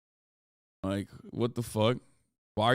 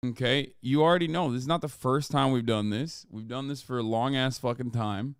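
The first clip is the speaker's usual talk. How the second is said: ordinary talk, nothing marked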